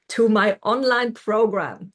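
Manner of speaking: ordinary delivery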